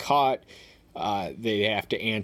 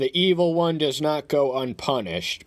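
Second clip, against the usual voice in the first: "the evil one does not go unpunished" declaimed higher and louder; scripture reading; 7 semitones higher, 5 dB louder than usual. Acted. First reading of verse.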